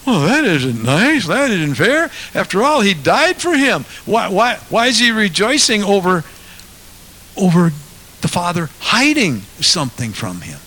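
high, indignant mock objection; a put-on whining protest; mock indignation. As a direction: play the offended objector.

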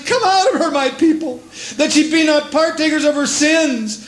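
high, urgent call of come out; fervent plea. Acted. Preacher voicing God calling his people out, urgent. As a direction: urge them out with passion.

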